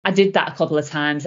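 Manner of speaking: ordinary delivery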